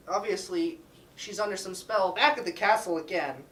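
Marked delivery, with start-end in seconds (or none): none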